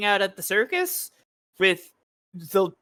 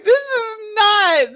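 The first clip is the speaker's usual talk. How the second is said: laughing; 13 semitones higher, 3 dB louder, slower than usual. Real, not acted. Cracking up mid sentence.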